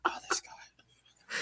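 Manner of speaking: slow exasperated whisper; annoyed Joker. Retold or imitated. Joker seeing his enemy turn up, hushed annoyance. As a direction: sigh it out slowly, barely voiced. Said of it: "He was legit annoyed".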